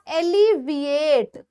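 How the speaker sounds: एलिविएट slowly, stretched on the last syllable; drawn-out pronunciation. Shown as a demonstration. Teacher contrasting it with elevate, एलिविएट slowly sounded out. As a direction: sound out एलिविएट part by part, with a long ending.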